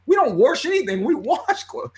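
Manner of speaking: high mocking voice, "wash" said as "warsh" in a Midwestern accent; Midwestern mom's "warsh". Son mocking his mom's Midwestern "wash" as he retells it, Midwestern accent. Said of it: "these Midwestern, like, accents".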